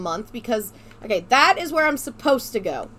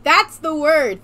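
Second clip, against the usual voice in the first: excited; 5 semitones higher, 8 dB louder, slower than usual. Real, not acted. Co-host finds the missing word.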